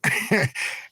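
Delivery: breathy high giggle; airy amused laugh; wheezy high laugh. Real, not acted. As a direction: laugh it off at the memory. Laughing at the trolls' joke.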